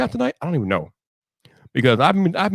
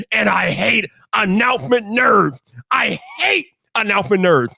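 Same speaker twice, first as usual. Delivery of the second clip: emphatic, raised-pitch angry rant; venting rant; 3 semitones higher than usual. Real, not acted. Streamer tells off complaining viewers on the channel, venting angrily. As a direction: stretch the hated words out in exasperation. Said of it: "So I had to let that out".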